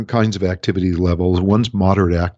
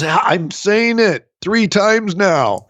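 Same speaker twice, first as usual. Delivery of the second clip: exasperated daughter; 9 semitones higher, much slower than usual. Retold or imitated. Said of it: "And she goes".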